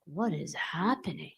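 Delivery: soft, slow inner thought; hushed bewildered thought in the head. Retold or imitated. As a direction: say it quietly to yourself, bewildered. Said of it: "like, in my head".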